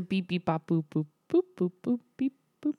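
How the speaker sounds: spoken beeps and boops; string out little computer blips; robot noise. Pretend. Host giving her robot noise on request, playful beeping. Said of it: "Let's hear your robot noise".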